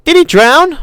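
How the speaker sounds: put-on high, loud little girl voice; a stubborn kid talking back; girl assuring her brother, voiced high; young girl. Lex voiced in the story.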